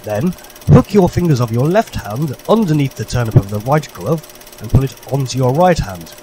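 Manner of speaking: ordinary talk, nothing marked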